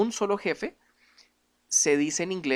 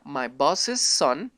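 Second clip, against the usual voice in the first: very slow, careful English sentence; slow English demo; much slower than usual. Shown as a demonstration. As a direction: model the possessive slowly. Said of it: "esto se pronuncia".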